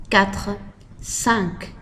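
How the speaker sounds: ordinary delivery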